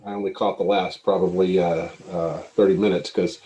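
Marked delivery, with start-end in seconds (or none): none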